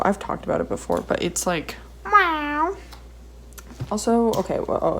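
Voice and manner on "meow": high cat meow. Pretend. Pen sound, cute noise.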